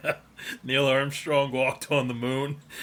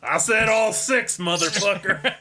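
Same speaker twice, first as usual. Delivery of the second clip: mock demand; 7 semitones higher, 6 dB louder than usual. Acting demanding beer buyer.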